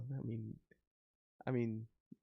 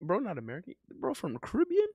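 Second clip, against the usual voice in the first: sudden rising disbelief, higher at the end; realizing mid-sentence, higher; retold surprise; 5 semitones higher, 3 dB quieter, faster than usual. Streamer retelling his realization about Quick Slap, surprised. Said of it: "I'm like".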